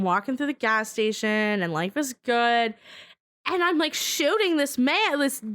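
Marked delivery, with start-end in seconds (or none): none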